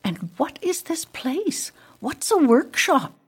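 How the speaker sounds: high-pitched puzzled questions; high, lilting newcomer's questions. A narrator's reading. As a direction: ask in bewildered wonder.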